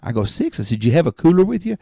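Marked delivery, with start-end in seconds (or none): 0.2-0.6 high stunned six; shocked high six; shocked question. Retold or imitated. Shocked at dad's eating. 0.8-1.8 incredulous question. Retold or imitated. Incredulous follow-up.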